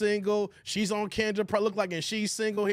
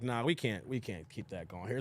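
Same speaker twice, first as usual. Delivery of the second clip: low muttered refusal; 11 semitones lower, 8 dB quieter than usual. Retold or imitated. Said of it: "I was like".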